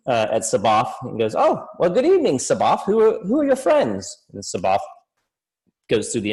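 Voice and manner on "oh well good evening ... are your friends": high, bright-voiced little halfling greeting. Halfling bard greeting the party in a high voice. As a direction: pitch up high as the small lute player.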